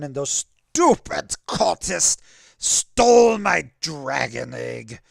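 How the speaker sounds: slow, loud furious roar; angry shout. Acted. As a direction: bellow it out in rage. Dragon rages over stolen egg.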